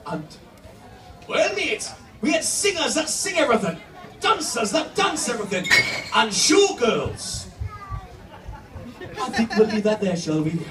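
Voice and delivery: usual speaking voice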